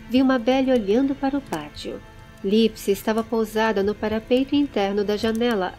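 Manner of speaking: ordinary delivery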